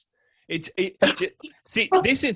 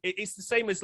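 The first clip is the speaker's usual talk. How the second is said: ordinary talk, nothing marked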